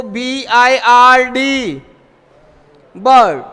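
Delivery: very slow, letter by letter; slow letter-by-letter spelling. Shown as a demonstration. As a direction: spell each letter out slowly. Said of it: "spelling letters slowly".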